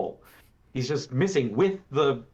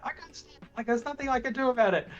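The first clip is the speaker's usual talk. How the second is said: high voice, imitating a guy who can't help himself; mock helpless lover; 12 semitones higher than usual. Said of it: "he's just like".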